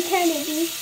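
talking while wiping face; muffled through cloth; towel over mouth. Real, not acted. Seen 5: Towel pressed over face and mouth at times while speaking.

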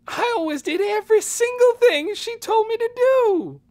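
high, loud wailing hurt protest; wounded wail. Acted. Amanda protesting to Nikki, wounded wail. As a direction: wail it like she was wronged. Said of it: "voice rose into a wounded wail".

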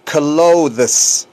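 drawn-out "clothes" for learners; model pronunciation. Shown as a demonstration. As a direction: say "clothes" slowly, every sound shown. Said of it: "Fíjense cómo se pronuncia".

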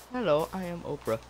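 quick, softly put-on celebrity greeting; lightly voiced talk-show host introduction; Oprah. Player showing off a celebrity voice to friend, Oprah impression. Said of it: "Would you like to listen to my Oprah voice?".

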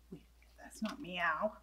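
whispered, leaning on not; a soft, puzzled whisper to the reader. Acted. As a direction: hush it as a confused aside. Aside at the wrong sound.

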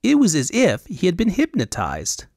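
high, airy Elvunian fop; high Elvunian accent. Acted. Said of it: "high Elvunian accent".

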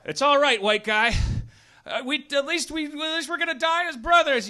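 high, loud mock-heroic comfort; dying soldier comforting. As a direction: reassure a comrade as the end comes. Acted soldier comforting comrade.

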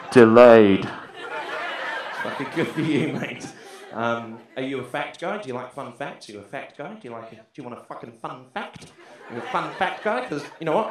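ordinary talk

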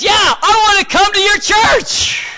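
very high, loud and stretched out; hammed-up excitement at full voice; eager shout. Leonard phoning Joel about church, acted eager shout. Caller eagerly asks to come.

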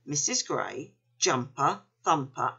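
plain reading voice

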